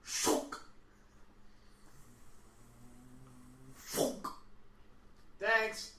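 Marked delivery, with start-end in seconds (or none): 0.0-4.3 pneumatic tube shunk. Retold or imitated. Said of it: "they go shunk".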